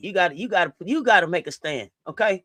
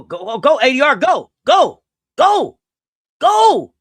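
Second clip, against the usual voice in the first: louder and higher with every go; shouted urging; 5 semitones higher, 10 dB louder, slower than usual. Real, not acted. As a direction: yell her down the court. Urging a player on a fast break.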